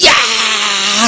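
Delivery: long drawn-out yell; mass shout. Acted. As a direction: shout it out like a huge crowd. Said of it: "라고 외치는 거예요". (길게 외치는 함성)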